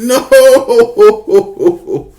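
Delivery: long high-pitched mock wail; anguished wail. Boy seeing his cow killed, anguished wail. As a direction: draw it out in exaggerated anguish.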